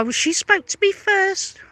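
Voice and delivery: high-pitched mimicry of someone's excuse; mocking quote. Mimicking the excuse that she spoke first, mockingly.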